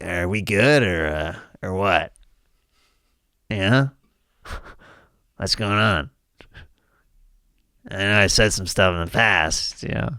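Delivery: low, soft podcaster impression; Marc Maron. Podcast host clearing the air with an old rival, low sheepish impression. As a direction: mumble it low and tentative, fishing for reassurance. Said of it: "My impression of Marc Maron".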